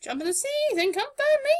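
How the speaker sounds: high, fast birdy voice; toucan voice. Acted. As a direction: squawk it like a bird. Voiced toucan/parrot line.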